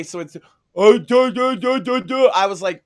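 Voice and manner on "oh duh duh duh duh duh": mocking gibberish. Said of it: "was that guy got Down syndrome".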